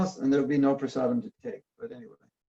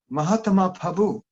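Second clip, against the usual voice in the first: put-on Chinese-accented greeting, "Prabhu" as "Pabu"; mimicked Chinese accent without clear r's; 3 semitones higher than usual. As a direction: imitate Chinese devotees, "Krishna" as "Klisna". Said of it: "when I go to China".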